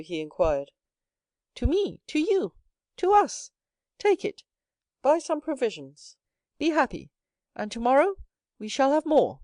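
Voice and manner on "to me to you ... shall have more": bright, much higher, rising delight; joyful. A narrator's reading. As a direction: share the good news with joy. Son joyfully gives father money.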